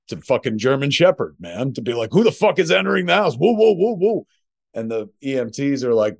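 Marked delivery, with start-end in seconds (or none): 2.1-4.3 high, loud, fast indignant dog voice; guard dogs voiced. Guard dogs barking at the ladder as a high, loud, fast outraged yell. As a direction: play the dogs as startled, outraged intruder-spotters. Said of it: "German shepherds appear at the window to be like".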